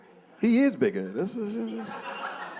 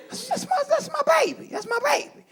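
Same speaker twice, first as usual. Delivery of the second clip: much higher, tender mock coo; proud cooing dad; 7 dB quieter than usual.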